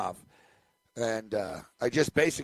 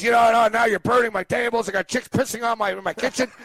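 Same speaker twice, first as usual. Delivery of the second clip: higher, louder mock rant; mock angry father; 8 semitones higher, 5 dB louder than usual. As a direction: ham up the father's complaint. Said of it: "He goes".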